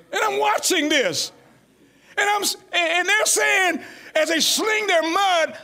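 high, loud preacher's cry; preaching at full force. Acted. Preacher crying out against mud-slinging leaders. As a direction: build to a shouting climax.

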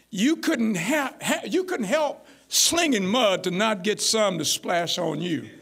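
ordinary delivery

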